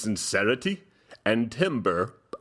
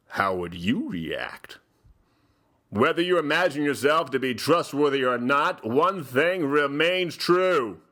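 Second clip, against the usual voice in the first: usual speaking voice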